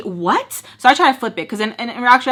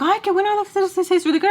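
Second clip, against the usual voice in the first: high, gushing imitation; high-voiced mock enthusiasm; imitating Reina; 10 semitones higher, faster than usual. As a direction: pitch it way up for a quoted compliment. Voices Reina talking to her.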